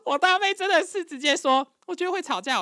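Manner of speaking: high, loud voice bubbling up from laughter; amused squeal. Real, not acted. Laughing as she retells the prediction. (笑着高声转述)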